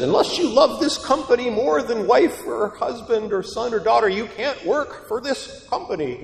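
imitated boss, pitched well up; high, insistent mock boss; demanding boss. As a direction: lay down absurd terms as a job interviewer. Acts a boss in job interview.

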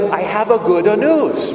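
a put-on Italian accent, drawn out and proud; thick Italian accent. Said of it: "in a thick Italian accent".

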